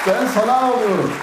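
cracking up on the punchline; laughing repeat. Retold or imitated.

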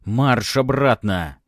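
very slow, loud order; loud command. Acted. Baron orders daughter back.

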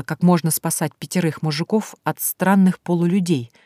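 plain reading voice

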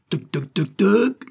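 clucking that leaps high and loud on the last toc; hen clucking. A narrator's reading. Young hen clucking as she lays, last toc high and loud. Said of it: "fit-elle".

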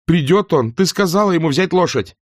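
high, urgent, pressing; impatient demand. Acted. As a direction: cut her off and demand. Demands answer from maid. (нетерпеливо, требовательно)

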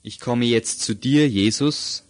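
slow prayer in short phrases, long pauses; leading a prayer. Acted. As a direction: pray line by line, leaving room to repeat. Leads a repeat-after-me prayer.

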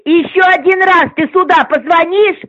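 ordinary talk

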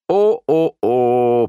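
loud, drawn-out swooning moan; hammed-up disappointed groan; theatrical groan. A narrator's reading. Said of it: "простонал Лео". (театрально простонать)

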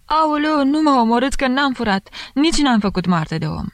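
țipăt ascuțit, mult mai sus; strigă ca din gură de șarpe. A narrator's reading. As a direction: Aoleu urlat înalt, disperat. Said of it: "începu să strige ca din gură de șarpe". (femeia lovită strigă ca din gură de șarpe, foarte sus)

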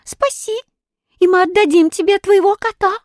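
very high little animal voice; high, squeaky pleading; squeaking monkey. A narrator's reading. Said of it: "пискнула обезьянка".

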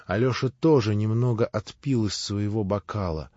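usual speaking voice

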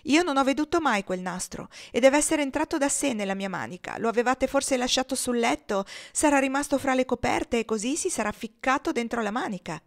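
mock-injured little fibber; pretending to cry. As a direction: plead with lightly faked tearfulness. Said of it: "facendo le viste di piangere".

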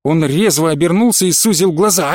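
ordinary delivery, nothing marked

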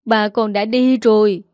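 choked with tears. Acted. Mother telling her daughter the father is gone, choked up. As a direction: say it through a tight, choked throat. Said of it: "Mẹ cô nghẹn ngào nói".

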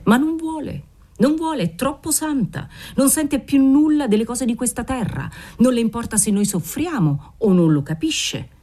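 high, pleading lament; rising anguish about the nun who won't help; anguished outburst. Acted. Father laments the saintly aunt.

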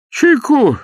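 high, loud eager offer; old man hustling Bender indoors; fussing old man. A narrator's reading. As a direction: press tea on him like a fussy old host. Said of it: "бормотал старик".

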